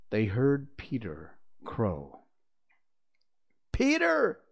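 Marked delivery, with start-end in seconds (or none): 3.7-4.4 high, loud call of his name; ringing, much higher shout; cried out. A narrator's reading. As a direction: cry out to Peter as he returns. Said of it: "they cried".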